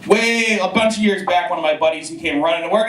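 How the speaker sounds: normal talking voice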